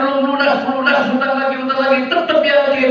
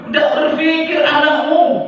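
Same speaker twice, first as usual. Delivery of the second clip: normal talking voice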